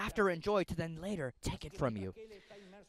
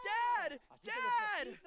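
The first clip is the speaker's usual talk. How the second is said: put-on high child's shout for his dad; boy shouting; 4 dB quieter than usual. Bound son shouting to his father, high child's shout. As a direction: scream for your father as a small boy. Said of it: "the boy shouts and screams".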